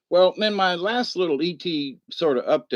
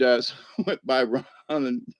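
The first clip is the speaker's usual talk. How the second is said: usual speaking voice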